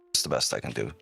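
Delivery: usual speaking voice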